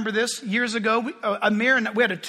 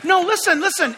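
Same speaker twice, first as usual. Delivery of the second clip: frantic objection; 8 semitones higher, 4 dB louder than usual. Retold or imitated. Retelling own protest.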